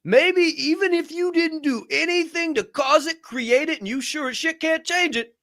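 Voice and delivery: very high, slow, drawn-out whine; high, slow, sing-song voice; exaggerated plea. Real, not acted.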